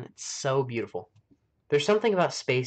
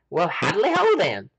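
mock greeting; 7 semitones higher, 7 dB louder than usual. Jokey exclaimed greeting.

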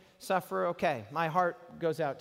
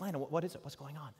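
hushed worried whisper; 2 semitones lower, 7 dB quieter, much faster than usual. Retold or imitated. Father whispers in tent.

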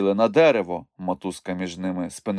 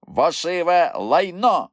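high, drawn-out angry insult; roaring; 9 semitones higher, 4 dB louder, slower than usual. A narrator's reading. Moomintroll attacks the bush's branch, roared insult. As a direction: bellow the insult slowly and fiercely. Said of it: "заревів Мумітроль".

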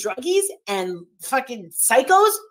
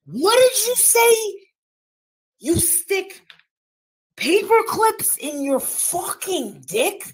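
higher-pitched, drawn-out incredulous questioning; retold disbelief; 5 semitones higher, slower than usual. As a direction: act out her stunned demand to be told, stretching the words. Retold shocked reaction of a panelist.

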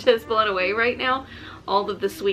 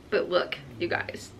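whisper; 2 semitones lower, 5 dB quieter than usual. Real, not acted. Showing the red barn.

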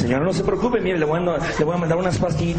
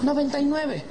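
high, mincing reply; mock-effeminate patient; 7 semitones higher, 5 dB quieter than usual. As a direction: answer the doctor in a camp, high voice. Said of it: "llega un putito".

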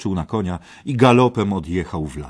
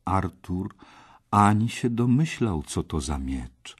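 ordinary delivery

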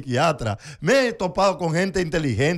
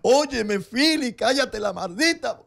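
higher, slower exasperated scold; a friend berating him, pitched up and drawn out; 6 semitones higher than usual. Retold or imitated. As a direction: scold him like a fed-up buddy.

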